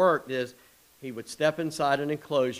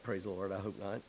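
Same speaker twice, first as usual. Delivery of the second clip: ordinary talk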